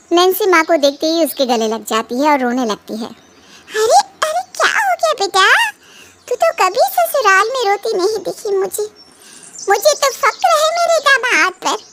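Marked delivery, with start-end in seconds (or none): 3.1-11.9 high, fussing mother's voice; mother consoles her crying daughter, worried. Acted.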